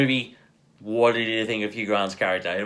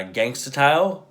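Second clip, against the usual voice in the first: ordinary delivery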